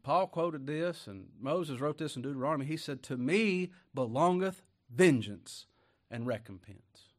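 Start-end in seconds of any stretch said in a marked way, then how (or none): 3.0-7.1 slow, loud scripture read that drops at the end; preaching scripture. Acted. Preacher quoting Deuteronomy to the congregation, slow and loud. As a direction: lay the verse out slowly like a preacher.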